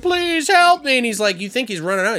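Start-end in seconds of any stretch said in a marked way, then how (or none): 0.0-1.0 high, loud plea; pleading victim. Retold or imitated. Dying neighbor begging the driver, high plea. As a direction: beg like a helpless old man.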